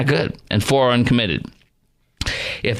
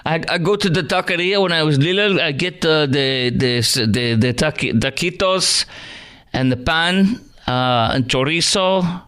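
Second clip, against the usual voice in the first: mock Spanish accent; 2 semitones higher than usual. Host parodies Harris, fake Spanish.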